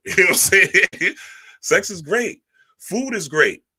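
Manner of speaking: ordinary talk, nothing marked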